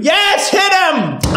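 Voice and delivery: high, drawn-out shout; gleeful cheering. Pretend. Fan cheering the girl hitting Joffrey, gleeful shout. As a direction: cheer them on with a high, stretched yell.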